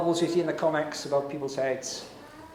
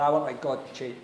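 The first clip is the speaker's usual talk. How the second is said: normal talking voice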